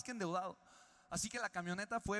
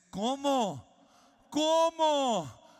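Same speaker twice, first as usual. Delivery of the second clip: high, loud astonished cry, repeated; stunned guest hearing sad news, loud disbelief; shocked disbelief; 7 semitones higher, 12 dB louder, much slower than usual. Retold or imitated. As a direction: gasp it out as if you can't believe it. John shocked father died.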